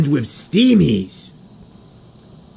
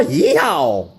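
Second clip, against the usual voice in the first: whooping yeehaw; 13 semitones higher than usual. Acted. Whoops at end of carol.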